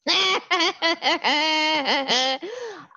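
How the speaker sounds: squeaky startled laugh; high shrill laugh. Acted. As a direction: shriek a laugh. Beetle reacts to insult.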